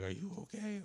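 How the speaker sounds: high-pitched imitation of a worried woman; mimicked concern. Acts out woman's concerned question.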